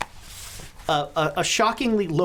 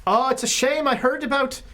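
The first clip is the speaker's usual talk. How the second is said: lightly voiced newspaper boss, higher-pitched sympathy; Mickey Mahoney; 6 semitones higher than usual. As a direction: offer condolences in character. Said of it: "the office of one Mickey Mahoney".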